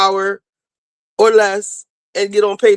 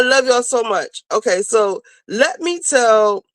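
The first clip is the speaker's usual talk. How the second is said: usual speaking voice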